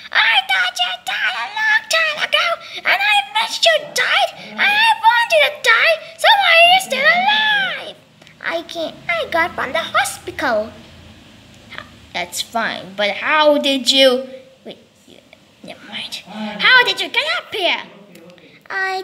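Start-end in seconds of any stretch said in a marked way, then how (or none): 0.1-8.0 tearful cry. Acted. Upset friend wailing at Billy who survived. Character cries out at Billy alive. 14.6-16.1 unvoiced whisper; whispered aside. Character whispering an aside mid-conversation. Aside muttered under breath.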